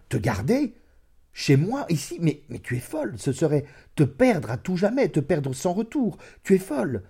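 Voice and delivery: high, loud, incredulous outcry; alarmed exclaiming. A narrator's reading. Lover refusing to keep her, loudly aghast. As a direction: cry out in shocked protest, voice climbing. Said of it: "Il s'écria".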